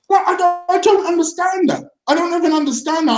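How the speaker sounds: fired-up defensive outburst; angry rant. Real, not acted. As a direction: let the indignation boil over, words driving hard. Angry rant about criticism.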